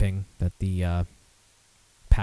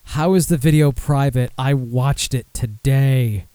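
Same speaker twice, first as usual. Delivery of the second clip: loud, indignant complaint, last words drawn out; exasperated; 3 semitones higher, 5 dB louder, slower than usual. Real, not acted. Host griping that the video went private, exasperated. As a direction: raise your voice in annoyed disbelief.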